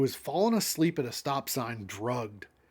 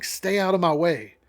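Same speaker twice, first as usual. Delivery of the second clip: raised, louder narrated shout; 3 semitones higher, 8 dB louder than usual. A narrator's reading. Monica barrelling forward in the truck, contained shout. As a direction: shout a warning, reading aloud.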